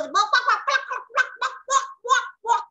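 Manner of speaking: high-pitched imitated hen clucks; rapid, squawky chicken noises; hen clucking. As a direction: cluck away like an excited hen. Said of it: "So the hen goes".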